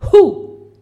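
short, loud high hoot; sudden boo. Acted. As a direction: startle them with a sharp "hu". Bearded man startles the women. (verso improvviso per spaventare)

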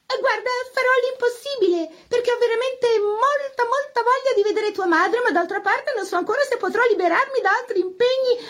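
high, prim piano teacher voice; a high, fussy teacher scolding over the lesson; fussy governess. Acted. Piano teacher correcting her pupil, high and prim. Teacher Isabelle speaks throughout.

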